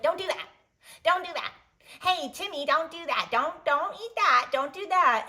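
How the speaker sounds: put-on a mom snapping at her kid over and over; scolding parent. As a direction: constant scolding at a child named Timmy. Parent scolding child Timmy.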